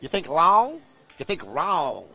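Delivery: loud, lightly put-on American accent; punchy American-accented saying. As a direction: quote it in a practised mock-American voice. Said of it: "that American accent that I practiced earlier".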